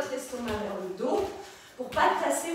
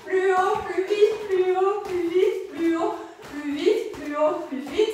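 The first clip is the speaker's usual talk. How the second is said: loud, higher-pitched drill calls, easing slightly; rhythmic loud workout cheer; urging coach; 4 semitones higher, 5 dB louder than usual. Real, not acted. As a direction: drive the class on. Coach driving the class on.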